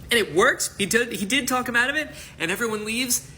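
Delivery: high, loud and fast with glee; real excitement; excited. Real, not acted. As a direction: burst out in delight.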